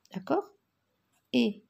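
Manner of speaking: usual speaking voice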